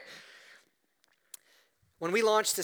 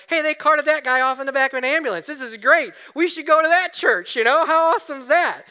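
much higher, louder, fast sarcastic gossip voice; mocking gossiping townsfolk; 10 semitones higher, 11 dB louder than usual. Pastor imagining the townsfolk gossiping, mock sarcastic voice. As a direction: ham up the town gossip mocking him. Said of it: "everybody's gonna know".

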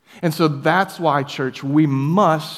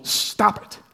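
breathy, higher hissed stop it; scolding whisper; 6 semitones higher than usual. Acted. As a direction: tell your own soul off. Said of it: "He says to his soul".

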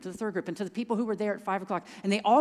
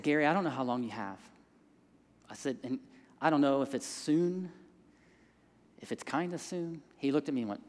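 soft, careful words; 7 semitones lower, 5 dB quieter than usual. Retold or imitated. Gently telling dying man hard news.

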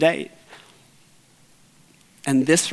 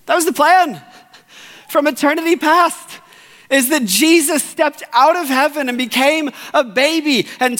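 raised, ringing preacher's voice; full-force preaching; 8 semitones higher, 3 dB louder than usual. Acted. Pastor preaching the cross to his church, fervent. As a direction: build the gospel story with fervour.

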